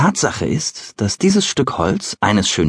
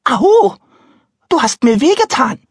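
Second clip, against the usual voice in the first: very high, louder, tiny wailing cry; 5 dB louder than usual. Acted. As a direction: cry out like a hurt little child. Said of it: "rief jammernd dasselbe Stimmchen".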